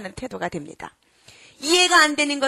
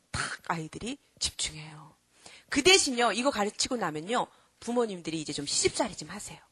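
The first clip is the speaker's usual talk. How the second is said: ordinary talk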